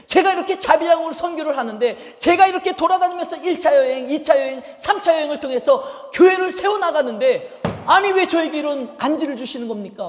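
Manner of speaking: resentful believer crying out to God; complaining to God. Acted. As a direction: plead and protest loudly to heaven. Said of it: "이렇게 원망할 수 있잖아요".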